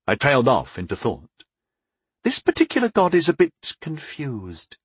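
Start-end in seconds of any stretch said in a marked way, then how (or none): 2.2-4.8 high-voiced goddess admitting confusion; puzzled confession. A narrator's reading. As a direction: confess bafflement in a higher voice. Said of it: "she confessed".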